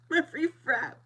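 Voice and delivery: laughing. Real, not acted. Laughing while recalling free drink.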